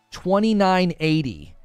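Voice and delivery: ordinary talk, nothing marked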